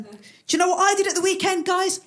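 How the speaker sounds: high, over-keen bragging; mock-excited student voice; bounding student. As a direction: announce it eagerly to the gang. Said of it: "bounding into the student union bar".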